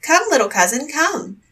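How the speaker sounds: put-on the boy calls the coffin bearers cheerfully; calling out. A narrator's reading. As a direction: call him over like family. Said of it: "cried out".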